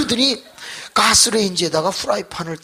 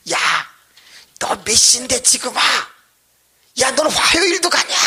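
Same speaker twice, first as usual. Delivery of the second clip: high, raised-voice telling-off; scolding husband; 9 semitones higher, 5 dB louder than usual. Retold or imitated. Husband scolding wife for coming home late, raised angry voice. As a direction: imitate a husband chewing out his wife. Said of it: "막 야단을 치더라는 거예요".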